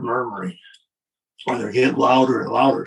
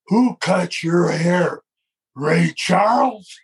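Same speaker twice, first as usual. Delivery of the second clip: slow mocking taunt; 4 semitones higher, much slower than usual. Drill sergeant insulting Vince's hair.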